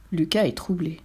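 plain reading voice

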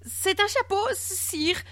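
high, flustered stammer. A narrator's reading. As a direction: stumble over it nervously before the king. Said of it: "bredouilla-t-il". (bredouillant, voix très aiguë)

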